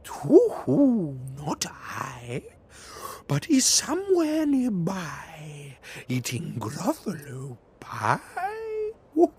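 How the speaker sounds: high, hooting owl voice, slowing at the end. Acted. Owl teasing the child about the mouse.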